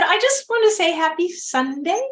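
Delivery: high, sing-out cover story; feigned innocence. Retold or imitated. Nosy neighbor dodging his question, feigned innocence. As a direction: play innocent, pitched well up.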